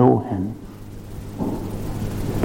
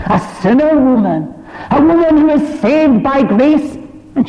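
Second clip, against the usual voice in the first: full-force preaching; 14 semitones higher than usual. Acted. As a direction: lift the whole room with the joy of it. Preacher lifts into proclamation.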